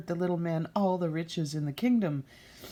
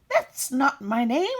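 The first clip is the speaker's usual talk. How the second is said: high, loud squeaky retort; high impish cry; called out by the imp; 8 semitones higher, 8 dB louder than usual. Acted. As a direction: shout it back in a goblin voice. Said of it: "the manikin called out".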